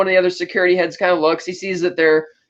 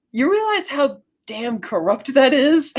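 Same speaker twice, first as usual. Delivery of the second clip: slow, higher-pitched incredulous question; incredulous indignation; 6 semitones higher, slower than usual. Retold or imitated. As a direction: spell it out slowly in mock disbelief. Indignant reaction to villain's guests.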